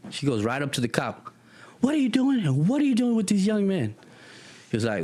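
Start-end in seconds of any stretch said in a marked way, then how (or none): 1.8-3.9 very high-pitched acted indignation; outraged scolding. Teacher confronting a cop holding her students, outraged high scolding. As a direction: take the officer to task, voice pitched right up.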